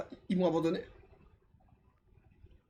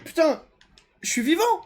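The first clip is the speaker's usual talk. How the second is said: excited shout; 7 semitones higher, 5 dB louder than usual. Real, not acted. Revived after dying in game.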